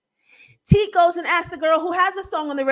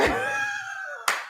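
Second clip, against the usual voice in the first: high squealing laugh, trailing softer; high-pitched laugh; 6 dB quieter than usual. Real, not acted. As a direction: crack up at your own quote. Cracking up at the quote.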